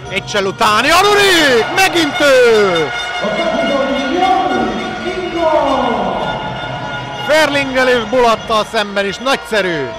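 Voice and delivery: shouting the keeper's name; loud, high, stretched-out save call; excited sports commentary. Real, not acted. Another big save call.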